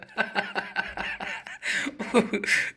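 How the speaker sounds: breathy burst of giggles; chuckling away; amused laugh. Real, not acted. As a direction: laugh at yourself, ending on a high-to-falling sigh. Laughs at making her usual word.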